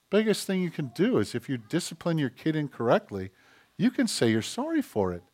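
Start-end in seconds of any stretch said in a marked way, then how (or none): none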